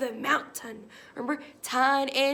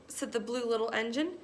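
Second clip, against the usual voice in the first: ordinary delivery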